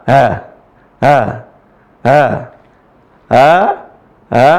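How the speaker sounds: ha softened halfway between a glottal a and h; in-between ha sound; between hamzah and ha. Shown as a demonstration. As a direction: breathe a half-hamza ha. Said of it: "Gimana cara bacanya".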